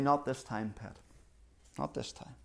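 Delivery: low, soft acted resignation; hushed, resigned aside to a spouse; quiet disappointment. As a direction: say it low and softly, as a couple sharing disappointment. Couple's monthly disappointment, voiced.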